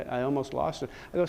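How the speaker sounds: normal talking voice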